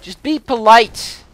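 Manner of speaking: loud, slow mock protest; a hammed-up loud plea to the monster, drawn out; yelling at the gargoyle to behave, mock plea. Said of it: "And I'm like".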